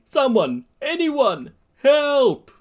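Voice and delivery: high, loud desperate calls; pained cry for help. Acted. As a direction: cry out in agony, word by word. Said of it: "Ida cries out in pain".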